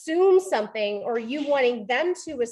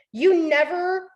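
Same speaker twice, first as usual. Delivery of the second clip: higher, louder mock accusation; accusing; 5 semitones higher, 5 dB louder than usual. Partner accusing the other of absolutes, accusing. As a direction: come in hard with the blame. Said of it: "We talk about absolutes".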